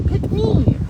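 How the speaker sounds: high, loud "pick me" cry; loud mock plea. Imagining the courage to ask to star, loud mock plea. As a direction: call out for attention, high and loud.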